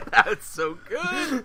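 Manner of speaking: high, loud, drawn-out laughing praise; cracking up. Real, not acted. Co-host delighted by the ruined joke, laughing hard. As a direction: laugh right through the words.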